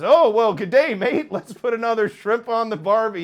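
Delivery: loud, high hammed-up Aussie tourist voice; over-the-top Aussie accent quoting a movie joke; mock Australian accent. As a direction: ham up a cartoon Australian greeting. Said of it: "And he says".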